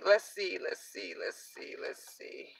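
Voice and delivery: fading from a murmur into a bare whisper; muttering while searching, trailing off to a whisper; muttering to herself, fading. Real, not acted. Searching for her papers on camera, muttering and fading to a whisper.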